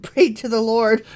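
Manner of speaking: ordinary talk, nothing marked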